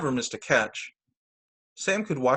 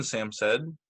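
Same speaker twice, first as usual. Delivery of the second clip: usual speaking voice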